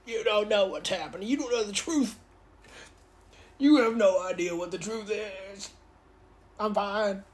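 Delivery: mock dying villain. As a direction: ham up a stabbed man's last secrets. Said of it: "he's like".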